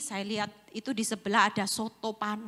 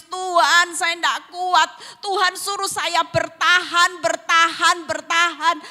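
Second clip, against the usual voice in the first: loud tearful prayer; 8 semitones higher, 10 dB louder than usual. Retold or imitated. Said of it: "Saya nangis".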